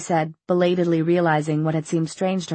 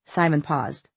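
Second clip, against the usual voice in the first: plain reading voice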